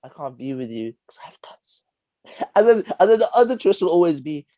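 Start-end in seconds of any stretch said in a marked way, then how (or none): 0.1-2.5 hushed, drawn-out mock confession; mock tragic whisper. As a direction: whisper it slowly like a hammy doomed lover. Acting out the film's tragic twist.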